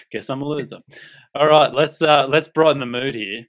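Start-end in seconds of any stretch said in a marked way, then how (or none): 1.4-3.4 louder, brighter kick-off to lift the mood; upbeat switch. Real, not acted. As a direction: pump up the room for the next bit. Switching to the fun segment.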